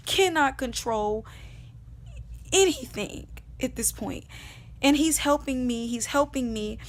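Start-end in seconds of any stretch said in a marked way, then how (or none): none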